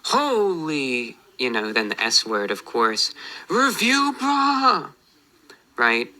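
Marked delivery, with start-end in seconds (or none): 0.0-1.2 high, drawn-out pretend holler; mock yell. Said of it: "at the top of your lungs you say". 3.5-4.9 very high-pitched pretend holler; mock yell. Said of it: "at the top of your lungs you say".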